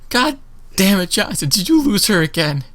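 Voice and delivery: high, scolding guard voice; exasperated guard. Acted. Forgetful guard scolding Johnson again, high and exasperated. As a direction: scold him as if it's new. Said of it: "she leans around and goes".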